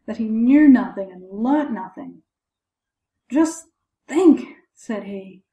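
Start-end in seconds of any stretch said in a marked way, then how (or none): 3.3-4.5 high, louder exclamation of dismay; bewailing. A narrator's reading. Father telling the sexton his trouble, bewailing. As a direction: lament it to a listener. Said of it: "the father bewailed his trouble".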